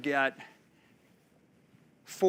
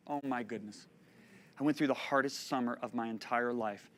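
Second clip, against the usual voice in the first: ordinary talk, nothing marked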